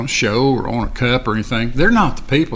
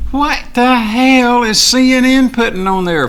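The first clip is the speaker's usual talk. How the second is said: high, loud and slow indignant yell; angry rant; 10 semitones higher, 4 dB louder than usual. Real, not acted. To the news channel, a loud, high, slowed angry rant. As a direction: rail at them, slowly and high. Ranting about CNN on stream.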